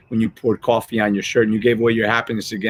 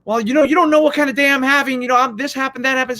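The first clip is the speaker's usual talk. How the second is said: much higher, put-on pleading voice; whiny excuse; 14 semitones higher, 3 dB louder, faster than usual. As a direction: squirm out of blame with weak excuses. Employee excusing himself to the boss.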